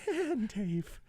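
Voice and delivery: high, drawn-out comic whine; squeaky, stretched-out mock plea; put-on squealing character voice. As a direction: plead in a goofy high voice. Same high comic plea to Dave.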